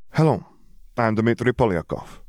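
put-on accented self-introduction, practicing English; foreign student's accented English. A narrator's reading. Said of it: "practicing his English or just very drunk".

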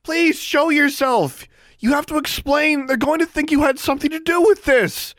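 very high, loud desperate appeal; pleading call. A narrator's reading. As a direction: beg someone hidden, calling at full pitch. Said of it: "she called out".

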